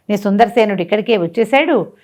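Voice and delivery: ordinary delivery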